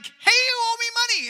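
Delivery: high, loud, brash demand for money; demanding creditor. Retold or imitated. Pastor playing the creditor asking for his money back, loud and brash. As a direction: bark it like a creditor who expects to be paid. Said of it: "you expect him to be like".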